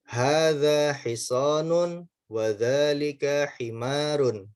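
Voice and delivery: slow, careful Arabic reading for pupils to repeat; slow Arabic drill read; slow model reading. Shown as a demonstration. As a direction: read it slowly, each syllable clear. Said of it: "baca sekali lagi".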